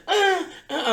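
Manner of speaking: high-pitched hammed-up moans into the mic; mock moaning dancer. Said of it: "on my microphone".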